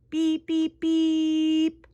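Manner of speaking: sustained monotone beeps; level beeps, the last one held; imitated tractor beeps. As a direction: beep like a toy tractor.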